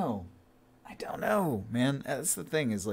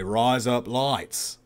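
razor blades with slow, wide Aussie vowels; Australian accent; much slower than usual. Shown as a demonstration. Demonstrating how Australians say razor blades, drawn-out Aussie accent. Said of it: "in an Australian accent, you say".

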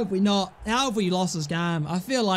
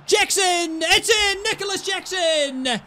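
high, loud excited shout of the scorer's name; goal celebration; 9 semitones higher, 3 dB louder, slower than usual. Real, not acted. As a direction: yell it like your team just scored. Goal scored in career mode.

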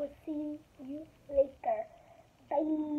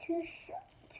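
ordinary delivery, nothing marked

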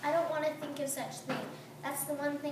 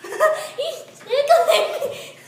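being tickled; 9 semitones higher, 16 dB louder, much slower than usual. Acted.

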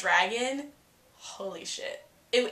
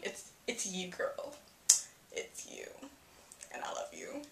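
much softer, breathy devotion; whispered confession; 10 dB quieter than usual. Real, not acted. Fan confessing love to Emma in whispers. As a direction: murmur it like a secret love note.

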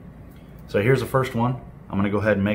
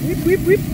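mock sound effect; 4 dB louder than usual. Sound effect while handling chicken.

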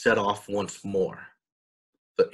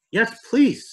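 put-on high, loud eager child's cry; 13 semitones higher, 6 dB louder than usual. A narrator's reading. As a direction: call back thrilled, pitched way up. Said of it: "Sarah and Salem cried back".

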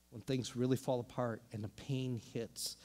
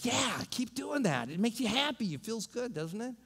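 voice of Happy Now; 7 semitones higher than usual. Acted. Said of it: "Happy Now says".

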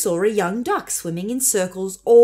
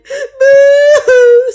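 high, loud echoing wail; echoed crying; 16 dB louder than usual. A narrator's reading. The echo answering back across the pond, loud high wail. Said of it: "said the voice from across the pond".

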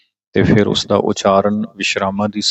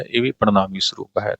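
usual speaking voice

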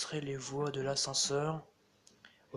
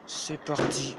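through a face mask; 5 dB louder, slower than usual. Real, not acted. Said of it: "Je parle avec un masque".

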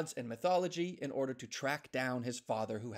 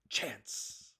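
whispered; 4 semitones lower, 6 dB quieter than usual. Acted. Whispered correction of name.

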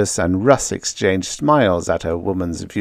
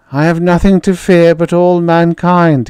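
high, loud, eerie echoing voice; an echo that won't leave him; haunting inner voice; 9 semitones higher, 5 dB louder than usual. Acted. Voice echoing in his head.

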